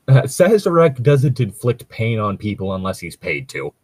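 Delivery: usual speaking voice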